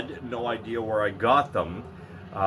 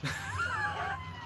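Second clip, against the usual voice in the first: real laugh breaking out high; cracking up at his own rant; high shrieking laugh; 9 dB quieter than usual. Real, not acted. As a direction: burst into a squealing laugh.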